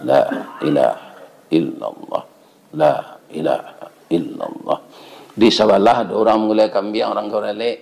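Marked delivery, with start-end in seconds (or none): 0.1-4.8 low, measured recitation of dhikr; tahlil recitation. Acted. Congregant at tahlil after dawn prayer, low measured chanting. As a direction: recite it low, in short separated phrases. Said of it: "Sedang tahlil".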